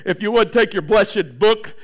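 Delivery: mock formal preacher announcement; put-on churchy reading voice; pulpit voice. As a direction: intone it like a stiff preacher at the pulpit. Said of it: "But then when I get up here".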